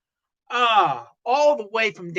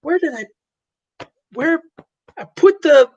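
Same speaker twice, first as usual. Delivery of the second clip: higher, slow, puzzled; a hint of a searching alien husband; 6 semitones higher, 4 dB louder, slower than usual. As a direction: mumble it out, much higher, lost and searching. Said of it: "male of the species going".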